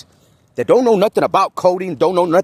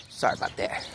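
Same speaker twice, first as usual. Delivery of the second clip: soft unvoiced sorry; whispered apology; 7 semitones lower, 13 dB quieter than usual. Real, not acted. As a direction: breathe the apology out. Apology after yelling.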